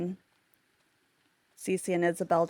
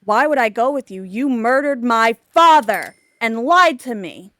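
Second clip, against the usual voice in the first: raised voice, hammering the accusation; angry accusation; 8 dB louder than usual. Retold or imitated. Goddaughter accuses Cece of killing her father, angry. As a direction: act out a betrayed daughter confronting her aunt loudly.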